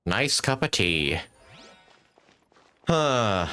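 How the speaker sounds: ordinary talk, nothing marked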